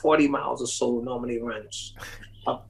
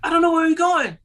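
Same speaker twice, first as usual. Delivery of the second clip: much higher, mock-frantic; retold panic; 13 semitones higher than usual. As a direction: squeak out the alarm of being lost. Retold panic at getting lost.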